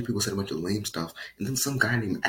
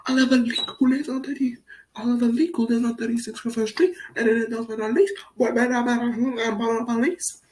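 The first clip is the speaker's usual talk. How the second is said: high, loud panicked mock neighbor; hysterical snitch; 11 semitones higher, 4 dB louder than usual. Outraged neighbor reporting Oliver's dogs, high loud panic. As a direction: shriek for the police like an outraged neighbor.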